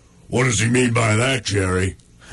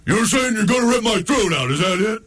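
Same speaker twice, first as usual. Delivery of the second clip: a much higher, worried backpedal; high dog voices; 8 semitones higher than usual. Acted. Coned dog backpedals to the pit bull, nervous. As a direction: hurry to explain away the threat.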